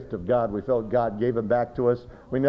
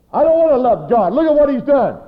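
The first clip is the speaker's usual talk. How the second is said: high, loud mock outcry; hammed-up anguished refusal; bitter protest, imitated; 13 dB louder than usual. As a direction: shout it as an aggrieved sufferer. Acted protest against God.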